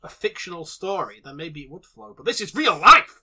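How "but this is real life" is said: loud mock-indignant outburst; emphatic protest. Podcaster insisting to co-hosts it is no story, loud protest. Insisting against fictional plot hole objection.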